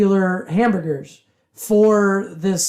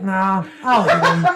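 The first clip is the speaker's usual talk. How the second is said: grudging mumble; 2 semitones lower, slower than usual. Retold or imitated. Imitating sulky homeless man.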